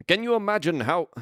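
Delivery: hammed-up, unconvincing Finnish accent; attempted Finnish accent. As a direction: try a Finnish accent and don't quite get it. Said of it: "I can't do a Finnish accent".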